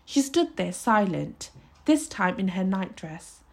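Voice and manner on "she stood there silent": ordinary delivery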